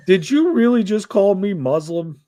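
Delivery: high, loud indignant question; half-joking disbelief, pitch jumping up; mock outrage. Real, not acted. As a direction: act like you just got insulted.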